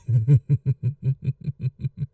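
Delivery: cruel chuckle. Acted. Vampire mocking the hunter's shudder, cruel chuckle. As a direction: laugh at her fear.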